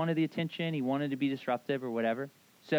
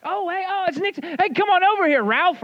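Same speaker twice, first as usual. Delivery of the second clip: high, loud, fawning sales clerk welcome; fawning salesmen; 11 dB louder than usual. Retold or imitated. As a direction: gush over the boss's son like an eager salesman. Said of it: "And they would be like".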